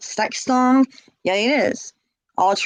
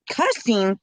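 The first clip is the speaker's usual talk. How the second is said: ordinary talk, nothing marked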